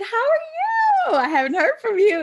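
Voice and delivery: high, loud eager call; excited greeting. Retold or imitated. Calling teammate Tony, high loud eager greeting. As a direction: burst in cheerfully.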